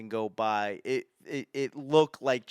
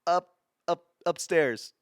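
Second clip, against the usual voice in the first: starstruck stammering, a bit higher and louder; flustered stammer; 5 semitones higher, 6 dB louder, slower than usual. Retold or imitated. Starstruck mall kid pointing Brady upstairs, flustered stammer. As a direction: stumble over the word like a starstruck kid. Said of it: "I was like".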